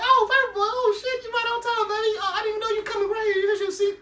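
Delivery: very high, gushing mock welcome; hammed-up delighted squeal of a welcome; eager greeting. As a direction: fawn over a customer in falsetto. Voices Bibby eagerly greeting Paperboy.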